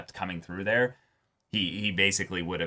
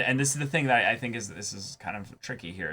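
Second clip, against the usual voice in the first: ordinary delivery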